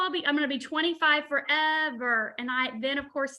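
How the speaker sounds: ordinary delivery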